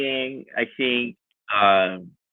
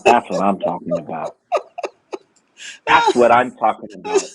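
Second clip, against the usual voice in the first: laughing; 4 semitones lower than usual. Retold or imitated. His proud reply, laughing.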